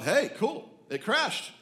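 high, pleased exclamation; excited scientists. Acted. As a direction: cheer the news like a delighted team. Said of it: "they all thought".

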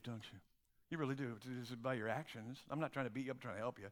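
ordinary talk, nothing marked

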